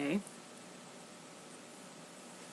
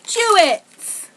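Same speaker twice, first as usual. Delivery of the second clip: excited; 14 semitones higher, 11 dB louder than usual. Real, not acted. Excited reading of candy name.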